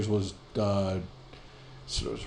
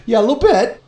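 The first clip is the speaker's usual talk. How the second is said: high, loud squeal of an answer; comic strained understatement; pained patient; 12 semitones higher, 9 dB louder than usual. Retold or imitated. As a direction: answer way up high, as if being tugged. Acts out patient's pained answer to doctor.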